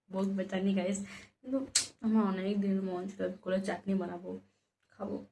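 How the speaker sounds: very low, hushed secretive voice; speaking softly. Real, not acted. Confiding in viewers behind mother's back, speaking softly. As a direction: keep it down so mum can't hear. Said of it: "আস্তে আস্তে বলছি".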